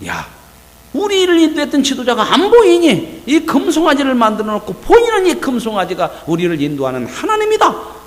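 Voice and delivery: high excited shout to the people; rowdy crowd proclamation. Acted. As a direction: whip up the crowd around the calf. People proclaim the golden calf.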